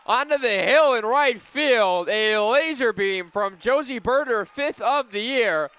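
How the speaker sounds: announcer still thrilled after the home run; excited call. Real, not acted. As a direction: savour the home run, stretching it out.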